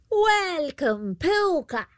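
high, drawn-out banshee welcome; high-pitched storybook banshee greeting; banshee calling out. A narrator's reading. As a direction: call out high as an old banshee. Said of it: "she called out".

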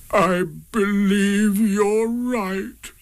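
sad sigh. A narrator's reading. Eeyore accepts his tail is gone, slow and sighing. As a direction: let it out on a long sigh. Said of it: "with a long, sad sigh".

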